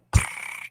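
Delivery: short purr rolled on the tongue; tongue-trilled purr. Shown as a demonstration. Showing how she would purr, trilled with the tongue. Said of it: "if I'm gonna purr, it's gonna be like".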